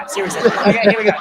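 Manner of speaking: ordinary talk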